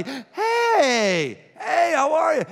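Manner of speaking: high loud happy shout; excited greeting. Shown as a demonstration. Greeting a friend, high loud excited call. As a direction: call out delighted to see them. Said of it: "excited to see somebody".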